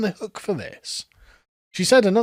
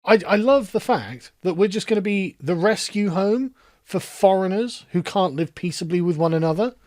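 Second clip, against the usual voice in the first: ordinary talk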